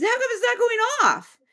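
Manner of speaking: high-pitched baffled voice; puzzled spirit. Retold or imitated. Unseen prankster finds the sensor dead, high baffled voice. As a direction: play a prankster whose toy has failed. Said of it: "He goes".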